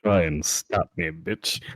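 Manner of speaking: low, slow mock menace; menacing threat.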